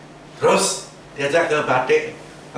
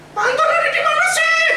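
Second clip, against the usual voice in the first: much higher, louder call across the shop; mock shrill call; 4 dB louder than usual. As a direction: imitate the rich lady calling out for him. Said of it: "Eh, dia teriak".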